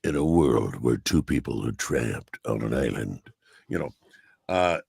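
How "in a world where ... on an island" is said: deep movie trailer voice; Don LaFontaine. Retold or imitated. Imitating the movie trailer voice for a host, deep announcer. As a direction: drop low for an epic trailer intro. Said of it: "he is the movie trailer voice".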